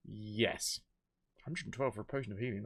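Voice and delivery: normal talking voice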